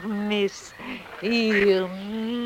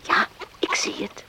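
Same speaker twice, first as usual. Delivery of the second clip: soft whispered agreement; whispering; 6 dB quieter than usual. Acted. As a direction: barely breathe the answer. Answering while watching ducks. (zacht gefluisterd)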